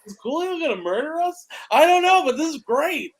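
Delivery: high, thrilled and scared at once; half panicked, half delighted; excited. Retold or imitated. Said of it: "We're like".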